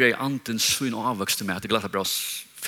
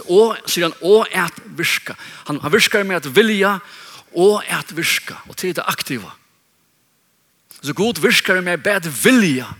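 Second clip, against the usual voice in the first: raised pitch, loud punched key words; emphatic preaching; 6 semitones higher, 5 dB louder than usual. Real, not acted. Preacher explaining to congregation how God's Spirit works, emphatic preaching. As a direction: drive the teaching home forcefully.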